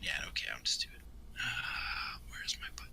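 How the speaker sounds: whispering. Real, not acted. Quietly rolling the dice, whispering.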